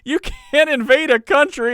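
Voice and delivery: high, slow whine of objection; mock indignant protest. Host mimics officials objecting to Trump, mock indignant. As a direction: object helplessly in a put-on high voice.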